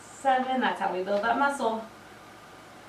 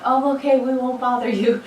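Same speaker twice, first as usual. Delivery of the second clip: lightly imitating agreeable relatives, pitched up; louder, lifted mock reply; mock-obliging reply; 4 semitones higher, 5 dB louder than usual. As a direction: read the text back in a higher, put-on voice. Voicing family's texted reply.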